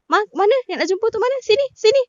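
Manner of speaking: sharp, high mock scolding; fierce. Auntie scolding the speaker at the mosque, fierce. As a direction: snap at her to come over. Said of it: "Memang garang gila".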